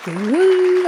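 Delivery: high whooping cheer; excited cheer. Real, not acted. Vlogger cheering her subscriber count, high excited whoop. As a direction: whoop for the small win. Celebrating twenty-three subscribers.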